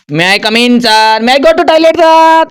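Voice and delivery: a schoolkid begging to leave class, slow and high; mock pleading student. Teacher mimics a student asking permission, high childish whine. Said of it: "जिंदगी भर का कहता है".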